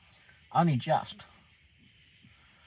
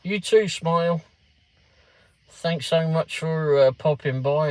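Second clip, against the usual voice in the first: louder, slowed thank-you to a viewer; cheerful farewell; 4 semitones higher, 7 dB louder than usual. Real, not acted. Streamer thanks a departing viewer, louder. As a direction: call out your thanks warmly.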